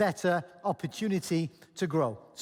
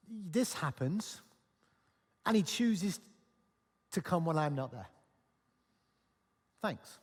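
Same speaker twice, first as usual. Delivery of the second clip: bitter sarcasm; 5 semitones lower, 7 dB quieter than usual. Retold or imitated. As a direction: let the hurt leak out as soft sarcasm. Thomas sulks about missing Jesus.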